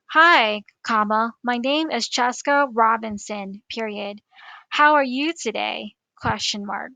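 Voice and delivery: loud, deliberate dictation, speaking the punctuation aloud; dictating to voice typing. Shown as a demonstration. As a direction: spell out each comma and period for the microphone. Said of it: "If you click on the microphone icon and start speaking".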